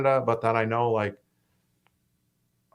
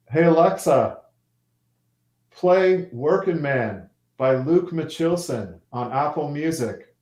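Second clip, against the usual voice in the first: raised, higher, deliberate command; calling to voice assistant; 4 semitones higher, 5 dB louder than usual. Real, not acted. Musician orders Alexa to play his song, calling out. Calls out command to smart speaker.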